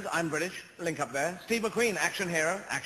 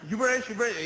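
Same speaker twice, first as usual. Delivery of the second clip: mimicking the American; 6 semitones higher, 4 dB louder than usual. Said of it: "And I go".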